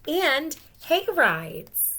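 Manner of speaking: high, loud and drawn out; hay rides called out high and slow; excited. Acted. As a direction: savor the fun of hay rides. Excited listing.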